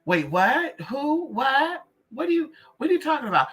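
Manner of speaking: high, fast, playing dumb; mock confused ex. Mocking the ex who stole her money, high and flustered. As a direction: squeak it out, pretending innocence.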